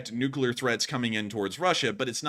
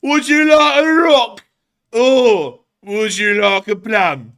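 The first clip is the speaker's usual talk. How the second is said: very high, loud, slow goofy voice; squeaky cartoon character offering a plum; silly creature voice; 10 semitones higher, 14 dB louder, slower than usual. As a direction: coo the offer like a cartoon critter.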